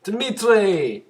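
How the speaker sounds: slow, loud, drawn-out name; dramatic reveal. Acted. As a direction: announce the villain like a trailer.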